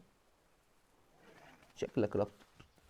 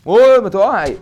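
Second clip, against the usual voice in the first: loud, high outburst; exasperated yell; 6 semitones higher, 12 dB louder, slower than usual. Real, not acted. Urging the animal to drop, loud high yell. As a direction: yell it out, pitched up.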